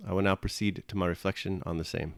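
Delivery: ordinary delivery, nothing marked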